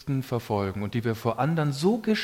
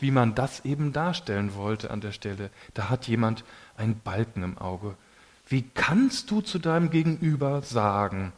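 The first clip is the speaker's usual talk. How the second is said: ordinary talk, nothing marked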